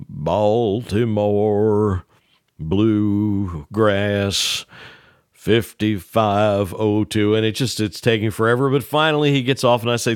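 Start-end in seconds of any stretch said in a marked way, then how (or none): 0.1-7.4 very slow, word by word with long pauses; slow dictation. Retold or imitated. Ned dictating the address slowly to Earl. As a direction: dictate "Baltimore Bluegrass, fifty-five oh two" drawn out and spaced.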